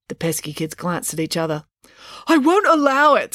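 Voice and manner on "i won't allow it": high, loud furious refusal. Acted. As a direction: refuse in outrage. Dad furious at deportation.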